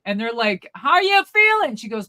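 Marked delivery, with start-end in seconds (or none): 0.8-1.8 high, loud imitated holler; mock yelling. Visitors checking on granny's health, mock yelling. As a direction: shout the question up high for an old lady. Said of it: "you don't need to yell".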